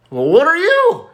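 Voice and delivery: a high hammed-up woman quizzing him; crush asking. Crush asks about costume.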